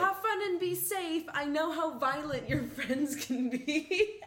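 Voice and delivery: high, loud, laughing mom. Retold or imitated. Host reading mom's text to friends, high fussy mom voice. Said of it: "this text of".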